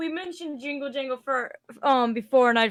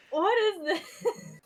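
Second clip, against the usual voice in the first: slow, higher, laughing what; laughing disbelief; 7 semitones higher, much slower than usual. Real, not acted. Player laughing at the creepy goblin picture.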